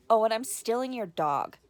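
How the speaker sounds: loud, cheeky parting shot; sassy quote. Retold or imitated. Quoting the niece's parting line, loud and cheeky. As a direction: toss it over your shoulder like a badass girl. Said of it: "she's like".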